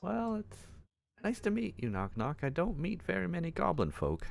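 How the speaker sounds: lightly voiced timid goblin; a little high, shy goblin greeting.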